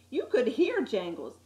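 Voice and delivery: usual speaking voice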